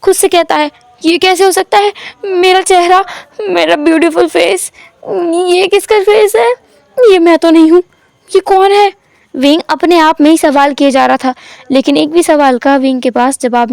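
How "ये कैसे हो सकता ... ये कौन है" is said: high, distraught cry; high, horrified questions to himself; shouting in shock. A narrator's reading. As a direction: wail at the mirror. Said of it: "wing बहुत ज़ोर से चिल्लाता है".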